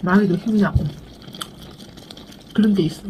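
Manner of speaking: talking between bites; chewing while talking. Real, not acted. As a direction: mouth partly full of food. Seen 0: Lips pursed and moving from earlier bite; spoon returns near the end.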